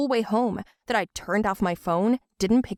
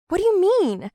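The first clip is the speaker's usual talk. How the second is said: a louder, higher outburst; sharp demand; 10 semitones higher, 6 dB louder than usual. Acted. Daughter demanding her mother explain, loud. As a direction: snap the question at her.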